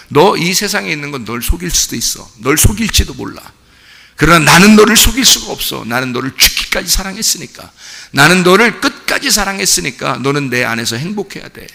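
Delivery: loud, high voicing of God's plea; fervent preaching. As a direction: plead as God with full force. Preacher voicing God to listener. (하나님의 목소리로 열정적인 설교)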